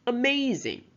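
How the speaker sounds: aMAZing, MAZ pitched high; "amazing" with a high, stressed middle; stress on second syllable. Shown as a demonstration. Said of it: "the second syllable, is underlined".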